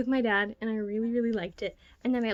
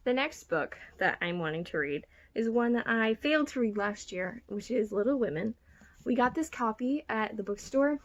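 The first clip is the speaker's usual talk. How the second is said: usual speaking voice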